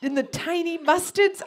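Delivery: mom impression. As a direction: gush about tiny mustards. Mom continues, laughing high.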